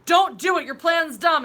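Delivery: loud, high mock pleading; hammed-up outraged objection; mock protest. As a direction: yell it like a feeble argument. Acts out heroes arguing with Thanos.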